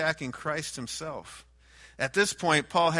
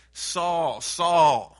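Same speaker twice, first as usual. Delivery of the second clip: loud call; 3 semitones higher, 8 dB louder than usual. Acted. The Lord calling Saul.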